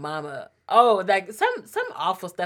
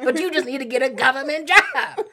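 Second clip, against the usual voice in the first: laughing mom quote; 6 semitones higher, 6 dB louder than usual. Retold or imitated. Mom's nagging advice, laughing.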